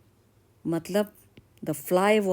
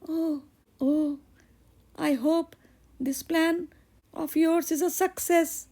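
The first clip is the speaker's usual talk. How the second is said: put-on high girlish voice, sweet then worried; young girl in love; 11 semitones higher than usual. Daughter tells the blacksmith her father won't approve, in a high girlish voice. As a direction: play the bright young daughter fretting about her father.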